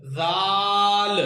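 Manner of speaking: जाल as zaal, light and long drawn; thin, fine letter. Shown as a demonstration. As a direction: model जाल thinly on one held note. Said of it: "बारीक पढ़ेंगे".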